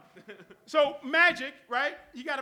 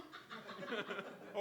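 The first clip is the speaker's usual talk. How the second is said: mock gasp; 2 semitones lower, 8 dB quieter than usual. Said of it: "Then you go".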